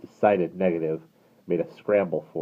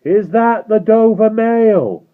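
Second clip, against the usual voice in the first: high, slow call across a distance; calling from afar; 15 dB louder, much slower than usual. Acted. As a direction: call out through the fog. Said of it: "a man's voice called from the mist".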